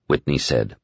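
ordinary delivery, nothing marked

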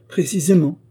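plain reading voice

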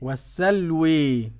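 slow "والسلوي" with a long final e; imala, ending in e. Shown as a demonstration. Student repeats Hamza's reading, "والسلوي" with full imala. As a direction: echo "والسلوي", tilting the last vowel fully to e. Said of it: "وخلف العاشر بالإمالة".

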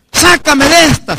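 high, stretched mock begging; desperate plea. Believers begging the Lord for rescue, high and drawn out. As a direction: cry out for rescue.

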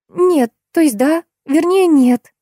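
high, slow, halting backtrack; hesitant high-pitched floundering; flustered faltering. A narrator's reading. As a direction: stumble over the answer, unsure. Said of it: "замямлила Жанна".

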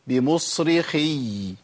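بمصرخي slow, ending on a long held y; doubled final ya. Shown as a demonstration. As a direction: draw out the last syllable of بمصرخي. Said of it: "لازم أقول".

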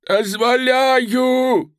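high, straining, long-held call; old man's feeble cry. Acted. Old hostage repeats consent from window, crying out high. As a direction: stretch the word out in a thin high cry. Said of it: "слабо кричал старик".

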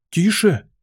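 high, alarmed exclamation; frightened hush. A narrator's reading. Rabbit silencing snorting Alice, frightened. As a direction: hush her in sudden fright. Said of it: "испугался кролик".

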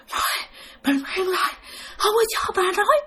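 high, choked, raspy voice; hoarse croak. Retold or imitated. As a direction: imitate a muffled, croaking spirit struggling to speak. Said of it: "khào khào vậy đó".

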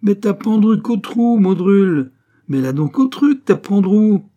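loud, high, tongue-tangled badgering; high-pitched pestering gone garbled; muddled frantic nagging. A narrator's reading. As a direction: keep hammering on, words getting scrambled. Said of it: "il s'embrouillait à la fin".

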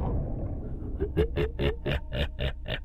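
breathy, long eerie laughter; evil ghost laugh. Acted. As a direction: throw back the head and cackle. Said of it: "ngửa mặt lên mà cười lớn".

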